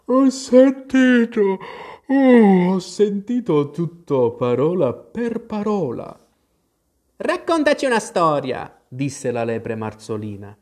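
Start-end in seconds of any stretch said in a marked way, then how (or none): 0.0-6.1 slow, high, drawn-out croak; hoarse just-woken creature voice; hoarse, sleepy Dormouse. A narrator's reading. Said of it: "disse con voce roca, flebile". 7.2-8.8 high-pitched storybook demand; eager high character voice; demanding March Hare. A narrator's reading.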